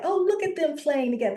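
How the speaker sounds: high, quick mock gushing; admiring onlooker. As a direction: coo over the children as an onlooker. Voices an onlooker watching children play.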